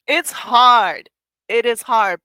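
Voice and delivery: "hard" hit loud and high; emphatic admission. Real, not acted. Emphatic admission after laughing.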